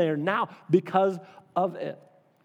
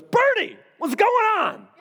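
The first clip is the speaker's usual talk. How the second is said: high, loud, cheery phone greeting; dad's hearty greeting; 5 dB louder than usual. Retold or imitated. Dad picking up the phone to greet Bernie, high and loud. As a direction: boom out a big friendly hello. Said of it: "That's just kinda how he did it".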